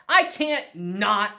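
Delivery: normal talking voice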